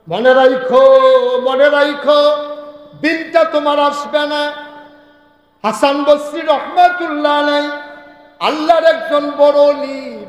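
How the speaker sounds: high, loud sermon chant; melodic preaching at full force. Real, not acted. Preacher warning the Muslims of the area nobody will invite them, chanted preaching.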